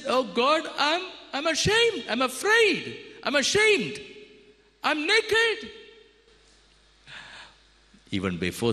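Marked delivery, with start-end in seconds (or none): 0.1-5.7 much higher, pleading confession; Adam cries out in shame to God; ashamed Adam. Acted. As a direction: plead as a frightened man caught out. Adam confesses, high voiced.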